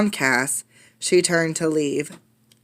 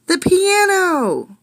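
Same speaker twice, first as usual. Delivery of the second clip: much higher, slow stretched wail of alarm; alarmed cry; 12 semitones higher, 3 dB louder, much slower than usual. Acted. Child wailing as the piano topples.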